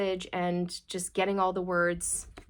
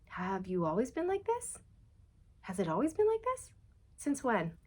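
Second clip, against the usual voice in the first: mild incredulous questioning; 3 semitones higher, 5 dB quieter than usual. Retold or imitated. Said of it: "looking at people in their life going".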